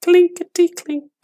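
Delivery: very high, tinny clinking voice; coins rattling. A narrator's reading. Coins rattling in the gravedigger's tin box, high clinking voice. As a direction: ring it out like tiny silver coins. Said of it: "it went".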